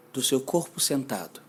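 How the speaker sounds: usual speaking voice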